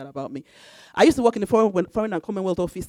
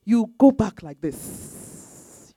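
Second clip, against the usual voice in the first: very slow, showing the backward step; drawn out, as if walking back slowly; slowly acting out the retreat; slow demonstration; much slower than usual. Shown as a demonstration. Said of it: "When you approach the Queen".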